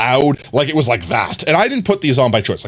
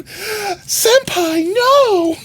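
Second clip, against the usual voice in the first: put-on much higher, squealing anime-girl cry; much slower than usual. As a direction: play a dramatic anime girl. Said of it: "Anime Oro Girl arc coming soon".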